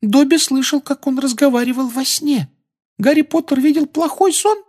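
very high eager house-elf; squeaky elf voice. Acted. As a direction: chatter on like a devoted elf. Dobby's held voice.

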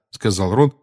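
usual speaking voice

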